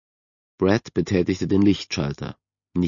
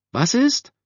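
very high-pitched calling question; called out. A narrator's reading. Mother calling back to her son, high-pitched shout. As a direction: call it up high. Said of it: "rief Mom".

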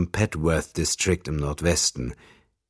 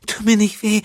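put-on very high, much louder frightened girl; whimpering; 9 dB louder than usual. A narrator's reading. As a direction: plead in terror with a knife at her throat. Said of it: "wimmerte sie". (hohes, panisches Wimmern)

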